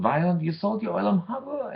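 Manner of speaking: wife frantic at the husband's foolish sale. Retold or imitated. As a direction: shriek it in shock at her husband. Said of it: "she was frantic".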